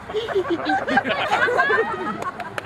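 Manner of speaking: put-on high-pitched girlish giggle; schoolgirl giggle. Silly knight performing on cue, schoolgirl giggle. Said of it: "he gives a girlish little schoolgirl giggle".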